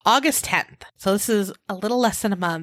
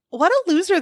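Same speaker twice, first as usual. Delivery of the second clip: high, mocking scoff; scornful; 9 semitones higher, 3 dB louder, faster than usual. Real, not acted. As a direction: sneer it out. Contemptuous jab.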